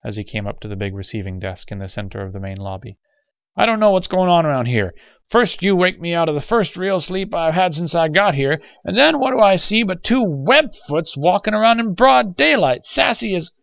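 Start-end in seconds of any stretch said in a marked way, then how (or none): 3.6-13.5 high, loud, indignant rant; raging complaint. Acted. Old man complains to the hospital nurse, ranting in rage. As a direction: pile up grievances until cut off. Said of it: "still trembling with rage and resentment".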